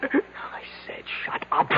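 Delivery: slow, harsh hissed command; hissed order. Acted. Husband silencing his despairing wife: slow angry hiss. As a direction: force it out through the teeth in a whisper.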